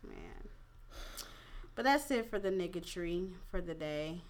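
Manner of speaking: ordinary talk, nothing marked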